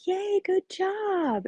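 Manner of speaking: high, drawn-out sing-song mock praise; slow, high-pitched patronising cheer; praising a little child. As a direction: cheer as if to a toddler, laid on thick. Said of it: "treating him like a little child".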